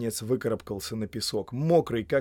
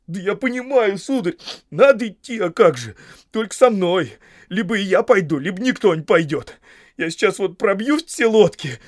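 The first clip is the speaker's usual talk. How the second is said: high, loud, agitated insistence; heated, pleading ultimatum; stubborn protest; 8 semitones higher, 9 dB louder than usual. A narrator's reading. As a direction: argue back and refuse to be left. Sam stubbornly argues, threatens.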